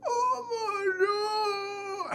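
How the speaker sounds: high, very slow crying oh my God; imitated crying. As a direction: mimic the girl's tearful wail. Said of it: "She goes".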